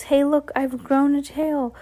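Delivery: high-pitched imitation of a startled girl; mock yell. A narrator's reading. Schoolgirl finding she has a tail, high mock yell. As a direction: exclaim high, in mock alarm. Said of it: "She yells".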